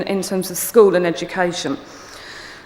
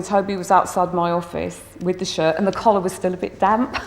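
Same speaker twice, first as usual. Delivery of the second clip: ordinary delivery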